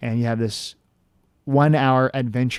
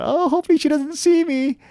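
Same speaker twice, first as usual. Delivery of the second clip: high, tense little voice, acting out hiding; high-pitched mock fright; mock scared. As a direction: retell a nervous plea, pitched way up. Retold fear while hiding.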